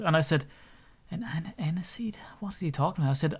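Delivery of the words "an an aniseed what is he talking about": hushed, baffled whisper to himself; puzzled whisper. Retold or imitated. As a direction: mutter it under your breath, confused. Bartender's puzzled aside.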